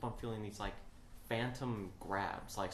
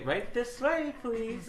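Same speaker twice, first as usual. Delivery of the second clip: high, louder guide voice; 13 semitones higher, 5 dB louder, slower than usual. Retold or imitated. Haunted house guide ushering, high voice. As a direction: usher them on. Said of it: "Then they".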